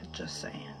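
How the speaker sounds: low hushed aside; whispered aside. Real, not acted. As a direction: mutter it under your breath.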